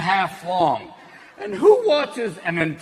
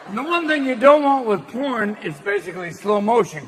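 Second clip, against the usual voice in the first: usual speaking voice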